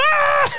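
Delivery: high-pitched mock scream; squealed cartoon yelp; yelp of pain. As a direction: let out a sudden sky-high "Ah!". Acting out sting of alcohol wipe.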